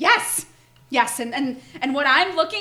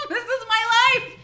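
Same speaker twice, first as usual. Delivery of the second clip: high laughing outcry; laughing shout; 9 semitones higher than usual. Acted. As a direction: burst out with a laugh. Mother bursts out laughing.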